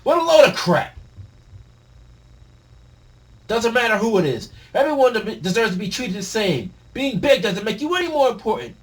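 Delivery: high, loud defiant yell; defiant shout. Acted. Harold defying Loki's bullying, defiant yell. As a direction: throw it back in his face.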